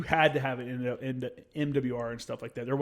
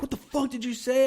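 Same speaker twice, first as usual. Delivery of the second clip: mad yelling; 10 semitones higher than usual. Retold or imitated. Marine up in the kid's face, mad mock yell. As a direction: re-enact getting in his face, pitched up. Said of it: "I don't yell at people, man. I only yell".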